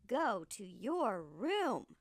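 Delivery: a mother sending her son off, each word heavy; angry mother. Acted. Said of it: "She was very angry with Jack".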